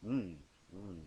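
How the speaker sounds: ordinary delivery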